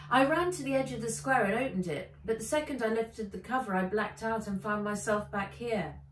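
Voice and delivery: fast, breathless excited telling; excited rushing. Acted. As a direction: rush through the story eagerly. Girl rushes her story.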